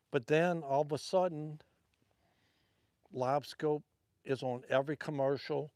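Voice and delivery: usual speaking voice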